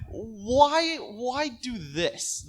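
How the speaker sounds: high, drawn-out incredulous question; exasperated pleading. Real, not acted. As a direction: stretch it out in exasperation. Exasperated challenge to the developers.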